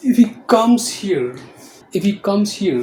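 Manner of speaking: emphatic example. Shown as a demonstration. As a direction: announce the example to the class. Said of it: "और एक sentence और".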